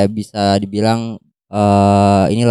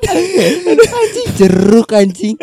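panicking gamer; much slower than usual. Real, not acted. Cracks up at the riddle answer.

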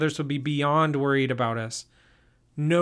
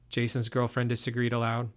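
ordinary delivery, nothing marked

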